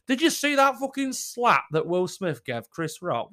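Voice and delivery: ordinary talk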